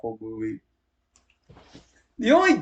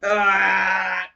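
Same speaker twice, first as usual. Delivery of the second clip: frustrated cry; 3 semitones higher than usual. Real, not acted.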